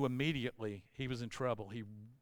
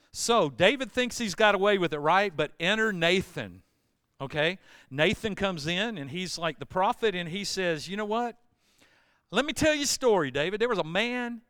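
loud sermon build; preaching at full force; 4 semitones higher, 8 dB louder than usual. Acted. Pastor preaching Nathan's story to the church. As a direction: drive the story home to the room.

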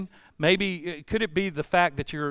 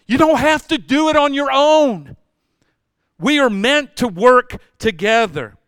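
much higher and louder, full force; loud preaching; 8 semitones higher, 8 dB louder than usual. Real, not acted. Pastor urging the congregation, loud full-force preaching. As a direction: hammer it home.

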